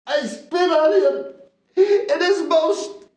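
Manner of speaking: high, breaking cry of shame; anguished confession. Acted. Guilty man confessing to the apostle, anguished high cry. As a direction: wail out the guilt, slow on the middle.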